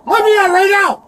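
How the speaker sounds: angry demand. Real, not acted. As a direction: yell to be let out. Arrestee demanding release.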